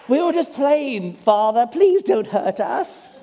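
high, slow, hammed-up British plea; exaggerated British orphan begging Father; mock British child. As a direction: plead like a posh British child. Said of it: "they turned British right then".

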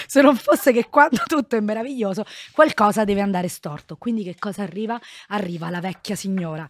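ordinary delivery

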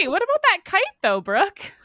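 playful ribbing in a put-on voice; teasing followers. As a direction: tease her about the kite like a nosy fan. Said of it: "They were like".

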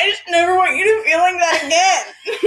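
high, mock-crying wail through laughter; hammed-up sobby pleading; imitated tearful plea. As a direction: wail it like a weepy friend, half laughing. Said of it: "And she starts crying again".

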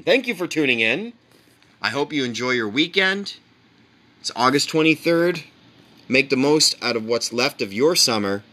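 mock show-host sign-off.

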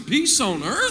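"Peace on earth?" with EARTH swung sharply upward; like a question. Shown as a demonstration. Preacher has church say it, questioning rise on "Peace on earth?". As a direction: make "Peace on earth?" a real question, rising on earth. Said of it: "Say that like a question".

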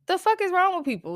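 high, disbelieving exclamation; incredulous outburst. Real, not acted. To people who pull guns over traffic, high incredulous outcry. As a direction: voice jumps up in outrage. Ranting about road rage.

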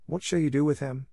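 a hint of a timid maid asking; Becky, curious. A narrator's reading. Becky asks.

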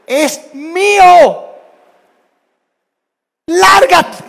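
high, loud, drawn-out shout; sibling yelling. Retold or imitated. Child yells at a sibling in a squabble, shouting. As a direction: scream like a kid defending territory. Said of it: "se gritan uno al otro".

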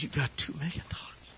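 whispered, stunned question; hushed disbelief. Retold or imitated. Pastor stunned by a stranger's loan offer, hushed. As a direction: ask it under your breath, barely believing it.

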